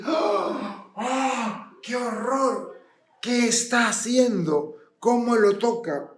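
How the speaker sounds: the crowd recoils in horror as Jesus touches the leper, gasping; horrified crowd. Acted. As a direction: gasp like a crowd shocked by the touch.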